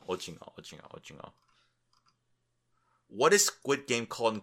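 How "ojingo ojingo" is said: usual speaking voice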